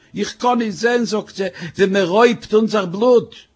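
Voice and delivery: agitated wife, voice pushed up; distraught outcry. Acted. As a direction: cry out that you can't bear it. Wife cries out at robbery.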